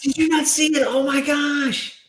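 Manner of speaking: can't believe they missed it; real surprise. Real, not acted. To a friend who missed the film, high astonished squeal.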